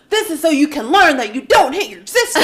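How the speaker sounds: hammed-up mom laying down the law; scolding mom. Sister voicing mom punishing her brother, laughing mock scolding. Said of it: "It's like".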